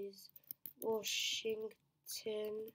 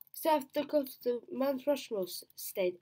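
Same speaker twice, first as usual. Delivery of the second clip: excited; 6 semitones higher, 7 dB louder than usual. Real, not acted. Sure of a quiz answer.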